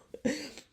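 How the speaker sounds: short high-pitched laugh. Real, not acted. Trader confessing he prayed two weeks ago, laughing. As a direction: burst of self-mocking laughter.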